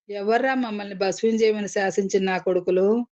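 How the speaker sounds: ordinary delivery, nothing marked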